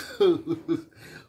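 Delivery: loud, high self-conscious laugh; real burst of laughter. Real, not acted. Preacher catching his own words: loud laugh. Laughs at himself mid-point.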